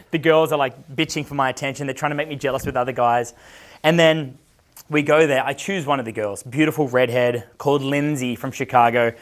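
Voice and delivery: normal talking voice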